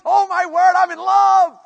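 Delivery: loud, high, head-over-heels outburst; rejoicing in love. Acted. Preacher acts out a happily married spouse, exuberant. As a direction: shout it out, thrilled to be smitten.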